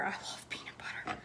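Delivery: hushed unvoiced confession; whispering. Shown as a demonstration. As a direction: whisper it lovingly. Whispers the same line.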